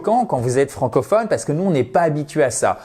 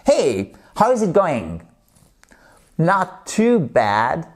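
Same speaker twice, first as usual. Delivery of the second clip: Hey, how is it going? Not too bad, drawn out slowly; slow model dialogue; 2 semitones higher, much slower than usual. Shown as a demonstration. Teacher repeats the dialogue slowly for students to copy. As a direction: spell the exchange out slowly for learners. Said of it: "Vous voulez qu'on répète?".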